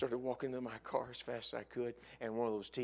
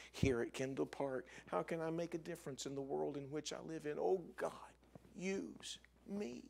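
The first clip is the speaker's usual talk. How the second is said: soft, higher-pitched heartfelt plea to God; hushed, sincere petition; earnest prayer; 5 semitones higher, 4 dB quieter than usual. Acted. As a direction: pray it quietly, rising as you plead.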